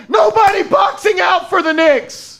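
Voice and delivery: high, loud angry yell; frustrated shout. Real, not acted. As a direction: blast the team for not boxing out. Fan furious at missed box-out.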